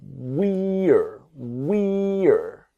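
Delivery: "we're" said with the mouth opening on the r. Shown as a demonstration. Teacher showing students the mouth opening on "we're". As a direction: open the mouth wide on "we're". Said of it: "the mouth opens here".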